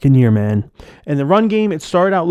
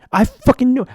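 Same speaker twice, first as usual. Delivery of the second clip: much higher, exasperated outburst; really mad; 9 semitones higher than usual. Real, not acted. Fan furious at the offense, high angry burst. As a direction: blurt it out in fury. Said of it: "why I'm really mad".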